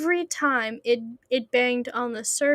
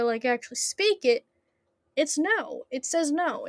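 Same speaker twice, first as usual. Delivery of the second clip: ordinary delivery, nothing marked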